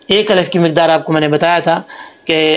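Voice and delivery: ordinary talk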